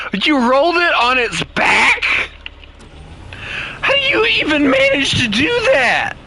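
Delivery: very high-pitched, amused disbelief; incredulous squeal. Real, not acted. Friend rolled the vehicle on its back, squealing incredulously. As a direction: squeal the question in real disbelief.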